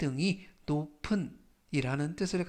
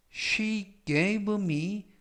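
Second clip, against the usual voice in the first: very slow and clear, word by word; 또박또박 아주 천천히; slow read-aloud; 2 semitones higher, much slower than usual. Shown as a demonstration. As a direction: read it out slowly for students to repeat. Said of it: "천천히 읽어드리고요".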